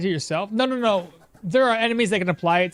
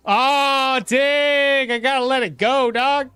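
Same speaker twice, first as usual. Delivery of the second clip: loud, higher cry of real dismay; frustrated outburst; 8 semitones higher, 7 dB louder, slower than usual. Real, not acted. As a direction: blurt it out, stung by losing the pick. Loses the choice in rhyme.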